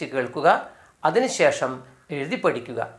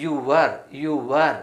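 slow drill; much slower than usual. Shown as a demonstration. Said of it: "എന്താണ്?".

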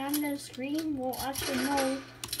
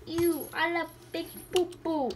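put-on silly baby talk, pitched up a bit; little baby voice; 3 semitones higher than usual. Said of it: "I'm talking like this. I'm a little baby".

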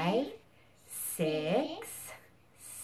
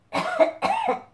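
high hammed-up cough; fake cough; 6 dB louder than usual. Shown as a demonstration. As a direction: cough it out big for the kids. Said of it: "Do the elephant when you cough".

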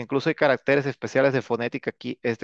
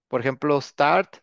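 normal talking voice

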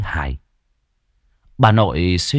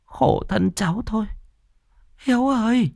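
higher, softer, a lightly voiced fond old woman; a hint of a grandmother cooing in pity; pitying grandma; 13 semitones higher, 5 dB quieter than usual. Grandma comforts her feverish grandson, soft and pitying. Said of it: "Bà nội suýt xoa".

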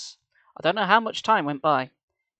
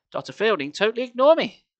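put-on higher, louder indignant girl; girl complains angrily, higher and louder; angry girl; 7 semitones higher, 5 dB louder than usual. A narrator's reading. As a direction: vent her anger. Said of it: "Sari cried".

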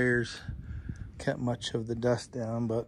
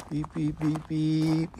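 truck backup beeps; 3 semitones higher, slower than usual. Retold or imitated. Imitating truck backup beeper.

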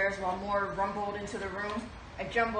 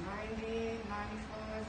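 much softer, counting under the breath; hushed counting; 9 dB quieter than usual. Acted. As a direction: trail off while counting heads. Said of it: "quiet counting then exclaiming".

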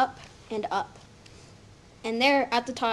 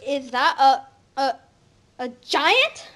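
stammering fright; 3 semitones higher, 6 dB louder, slower than usual. Acted. Mother sees the giant coming down, frightened stammer. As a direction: stutter in terror at the sight.

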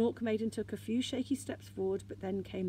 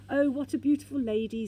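higher, louder wistful exclamation; sighed admiration; 5 semitones higher, 7 dB louder than usual. A narrator's reading. Moomin Mama admiring the figurehead, sighing. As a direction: sigh it out with a lifted voice. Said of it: "sighed Moomin Mama".

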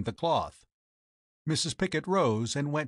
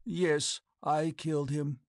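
dazed, mechanical confession; mechanical reply; 2 semitones higher, slower than usual. A narrator's reading. Captain confesses to killing his friend, mechanical dazed voice. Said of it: "Then, mechanically, he replied".

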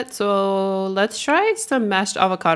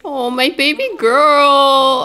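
cooing; 6 semitones higher, 5 dB louder, slower than usual. Real, not acted. Cooing at sim baby.

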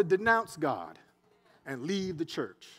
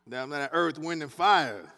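ordinary delivery

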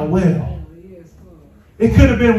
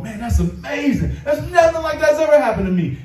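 mock exclaiming; 7 semitones higher, 3 dB quieter than usual. Voices congregation's doubtful reply.

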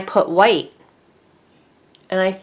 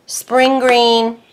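loud, high call of the colour name; yelling; 4 semitones higher, 5 dB louder than usual. Real, not acted. Crafter reading out a powder label, yelling. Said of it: "I don't know why I'm yelling".

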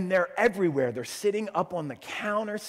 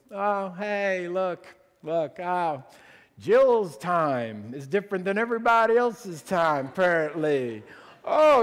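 sarcastic teasing; 3 semitones higher, 5 dB louder than usual. Retold or imitated. Worship team teasing the late pastor's wife, sarcastic. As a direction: needle her playfully for being late. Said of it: "using sarcasm as sort of our first language".

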